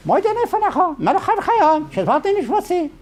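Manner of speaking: high squawky parrot reciting; high mock parrot prayer. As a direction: rattle it off like a trained parrot. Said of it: "I could teach, say".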